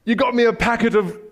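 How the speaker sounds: high, gushing gift reaction; playacted delighted surprise. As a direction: pretend to be thrilled by the present. Acts thrilled at imaginary present.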